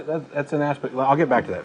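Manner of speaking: usual speaking voice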